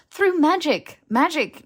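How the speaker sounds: blurted out loud and high; hasty exclamation. Acted. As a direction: quickly cut off the misunderstanding, loud. Hermione hastily correcting his misunderstanding.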